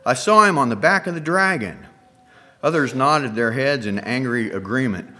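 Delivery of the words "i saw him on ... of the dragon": raised, louder accusing voice; crying out. A narrator's reading. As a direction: call out what she saw, outraged. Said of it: "one woman cried out".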